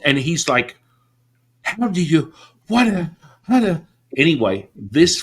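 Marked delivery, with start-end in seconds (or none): 1.6-3.8 high, broken stammer; flustered. Retold or imitated. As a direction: imitate a man lost for words. Flustered teacher lost for words.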